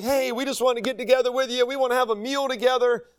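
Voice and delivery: mock a schemer coaxing people to join him; manipulative friendliness. Manipulator inviting his target to a meal, falsely friendly. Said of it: "It's all manipulation".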